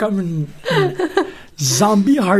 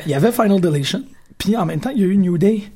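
ordinary talk, nothing marked

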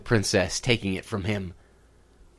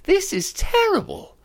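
high, loud despairing wail; moaning; 5 dB louder than usual. Acted. Princess wails about her ball lost in the well. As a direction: wail it like the world is ending. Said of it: "she moaned".

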